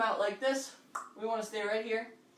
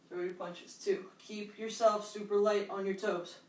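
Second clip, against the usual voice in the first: usual speaking voice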